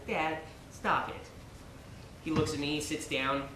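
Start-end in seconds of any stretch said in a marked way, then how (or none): 0.1-1.3 softer, slow murmured request; a son quietly asking his father to stop; quiet plea. Retold or imitated. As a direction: say it under your breath to dad. Son's first quiet request.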